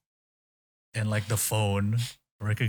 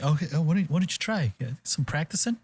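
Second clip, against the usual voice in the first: higher-pitched, lightly voiced encouraging teacher; coaching sensei; 8 semitones higher, faster than usual. Imitating sensei helping her practice, coaching. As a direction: lift the pitch and coax the student.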